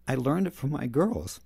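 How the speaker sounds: ordinary talk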